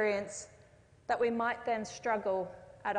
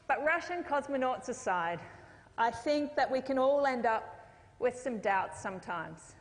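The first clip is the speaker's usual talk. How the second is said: normal talking voice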